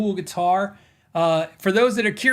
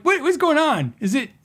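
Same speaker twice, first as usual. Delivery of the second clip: baffled; 10 semitones higher than usual. Retold or imitated. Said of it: "so I was like".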